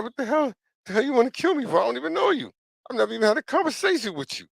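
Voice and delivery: very high-pitched incredulous protest; mock indignation. Replaying his reaction to a staring stranger, mock indignation. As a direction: protest in a comically high, baffled voice.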